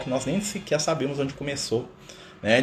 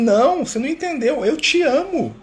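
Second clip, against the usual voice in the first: much higher, pressing the point home; insistindo no amor; 13 semitones higher, 4 dB louder than usual. Retold or imitated. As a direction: insist he really means it, pitch climbing. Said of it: "Aí eu falo assim". (insistente, bem mais agudo, reforçando eu te amo)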